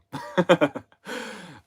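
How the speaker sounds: real burst of high, loud laughter. Real, not acted. Tickled by German slang, bursting into high loud laughter. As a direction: let a big delighted laugh out.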